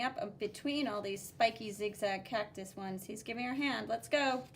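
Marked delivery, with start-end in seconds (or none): none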